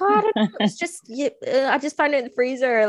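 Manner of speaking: high, breezy mock husband shrugging it off; mock husband excuse. Husband's casual excuse retold.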